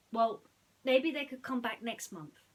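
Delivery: loud, softening compromise; Jasmine, relenting. Acted. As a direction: give in kindly. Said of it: "she added".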